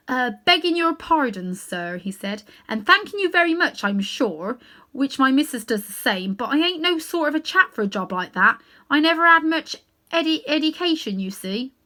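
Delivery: a London cabby fumbling for words; cockney cabby. Acted. Cabby answers the great lion, humble cockney voice.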